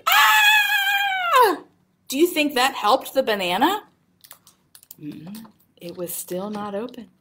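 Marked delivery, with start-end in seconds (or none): 0.1-1.6 long, very high tantrum squeal; drawn-out play scream, piercingly high; hammed-up scream. Reader acting out Betty screaming at the banana, mock tantrum. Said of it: "and screamed".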